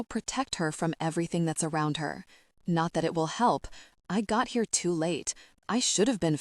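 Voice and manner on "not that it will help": plain reading voice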